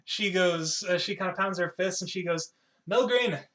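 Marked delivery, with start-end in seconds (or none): 2.9-3.4 loud, higher-pitched call. Acted. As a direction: shout the name across the forge. Pounds fist, calls for the smith.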